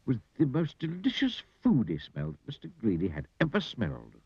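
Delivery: ordinary delivery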